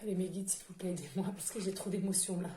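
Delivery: quiet, collecting herself after emotion; calming down. Real, not acted. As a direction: pull the voice down to steady yourself. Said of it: "je me calme parce que j'ai beaucoup".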